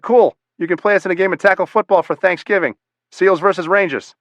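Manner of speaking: put-on eager Boston accent, jumping high and loud on Cool; lightly voiced Boston ranger, keen on a football challenge. Ranger challenges SEALs to Thanksgiving football, light Boston accent. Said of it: "said in a thick Boston accent".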